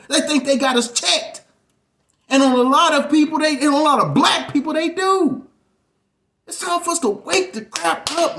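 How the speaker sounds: loud, high, fast shouted sermon; full-force revival preacher, furious; fiery preaching. Real, not acted. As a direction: preach it like a call to arms.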